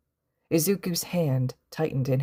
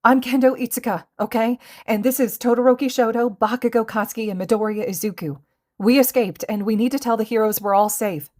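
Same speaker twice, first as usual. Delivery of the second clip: fast, higher, louder rush; quickly; 7 semitones higher, 6 dB louder, faster than usual. A narrator's reading. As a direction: blurt it all out in a hurry. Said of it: "she was saying quickly".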